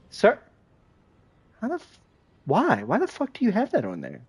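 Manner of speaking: high, stunned outburst at the enemy; disbelief. Real, not acted. Streamer shocked by an enemy tank's gear, high disbelieving outburst. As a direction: ask it in outraged disbelief.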